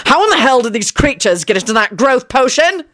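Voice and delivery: high-pitched, restrained outraged yell; angry scream. A narrator's reading. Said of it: "Shacklebolt screamed".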